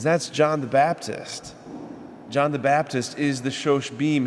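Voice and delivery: ordinary delivery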